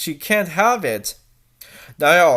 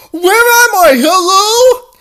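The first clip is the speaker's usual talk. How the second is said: high, loud, tearful calls into the dark; panicked calling out, near tears; frightened cry; 13 semitones higher, 9 dB louder than usual. Acted. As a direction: cry out, lost and scared. Waking in a strange room.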